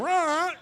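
high drawn-out bird squawk; sudden piercing parrot cry. Shown as a demonstration. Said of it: "rehearse that in the front of the mirror".

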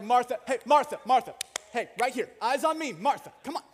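Jesus loudly snaps a distracted Martha to attention; calling for attention. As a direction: grab the attention of someone who isn't listening. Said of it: "Or he's like".

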